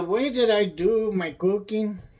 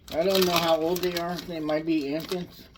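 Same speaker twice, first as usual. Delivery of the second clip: coughing through heartburn. Real, not acted. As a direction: talk between heartburn coughs. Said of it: "I have heartburn".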